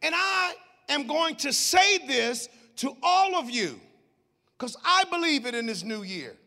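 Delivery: high, loud preaching build; full-force preaching. Real, not acted. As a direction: preach it out to the congregation.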